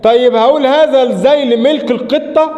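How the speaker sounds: ordinary talk, nothing marked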